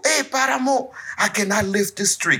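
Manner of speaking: high, pleading call for help; a villager calling up high to the elephant; high mock villager asking the giant for help.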